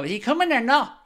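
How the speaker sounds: high, bratty mock of a rude commenter; snotty mimicry. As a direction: whine "or not" like a spoilt kid. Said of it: "your snotty attitude and just go".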